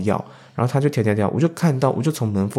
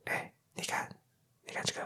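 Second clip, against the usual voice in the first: secretive whisper to a colleague; 19 dB quieter, faster than usual. Retold or imitated. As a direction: breathe it so the man next to you won't hear. Secretly showing phone to colleague. (悄悄对同事耳语)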